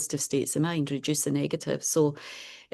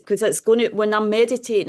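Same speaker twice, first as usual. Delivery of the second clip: raising the voice a little to carry to the back of the room; louder, projecting for the class; speaking louder; 3 semitones higher, 5 dB louder than usual. Real, not acted. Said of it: "I'll try and speak a bit louder".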